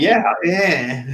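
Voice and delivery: laughing high mock whine; whiny voice. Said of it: "So that's my whiny voice".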